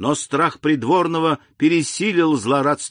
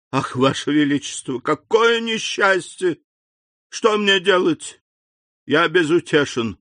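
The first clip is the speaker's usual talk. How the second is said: despairing; 5 semitones higher than usual. Acted. Minister in despair.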